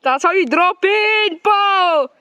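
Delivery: loud, slow cheering call; cheering shout. Real, not acted. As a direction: shout him on from the trail. Cheering a friend's drop-in.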